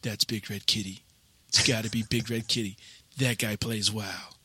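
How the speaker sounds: low, hushed certainty; softly sure, under the breath; hushed guess. Retold or imitated. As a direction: mutter your hunch to yourself. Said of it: "And I went".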